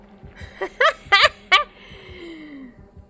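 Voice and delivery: loud, high burst of laughter. Real, not acted. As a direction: cackle that trails off. Laughing after squishy noise complaint.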